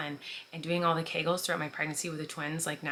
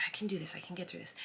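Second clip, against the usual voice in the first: soft whisper to herself, fading to breath; steady yourself in a hush; murmured self-reassurance before fainting; whispered pep talk; 9 dB quieter, faster than usual. Retold or imitated. Self-talk while about to faint.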